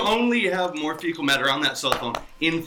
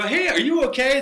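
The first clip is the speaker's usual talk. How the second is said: higher-pitched, genuinely asking; concerned, lifted-pitch question; sincere check-in; 8 semitones higher than usual. Shown as a demonstration. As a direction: ask as if you want the answer. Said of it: "then I wanna know before I leave".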